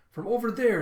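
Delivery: high, loud exclamation; emphatic call-out. Retold or imitated. Zoro answering where the booze is, loud call-out. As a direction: shout out where it came from. Acted reply, pointing out the source.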